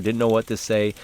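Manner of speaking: ordinary talk, nothing marked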